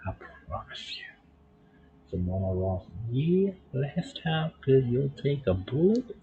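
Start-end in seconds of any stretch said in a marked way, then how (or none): 0.1-1.0 whispering. Acted. Voicing a promise to partner. 3.1-6.1 raised voice. Acted. Voicing a pleading game line.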